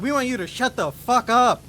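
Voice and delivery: loud, high mock scream; acted-out prank caller's full shout; retold screaming. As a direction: bellow it down the phone at her. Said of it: "to scream at her".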